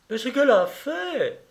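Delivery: high-pitched outburst of complaint; indignant exclamation. Acted. Sylvie complaining to guests about her young cousin, indignant cry. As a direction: cry out in exasperation, pitch leaping up. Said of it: "s'écria Sylvie".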